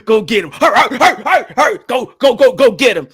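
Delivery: hyped-up dog owner urging his pit bull on. Retold or imitated.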